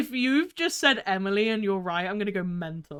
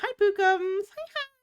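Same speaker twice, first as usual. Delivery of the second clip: high, squeaky little hello; cutesy greeting; 10 semitones higher than usual. Real, not acted. Streamer greeting a friend in chat, cutesy high voice. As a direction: greet them in a tiny high voice.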